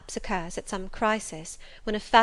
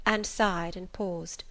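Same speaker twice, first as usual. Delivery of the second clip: plain reading voice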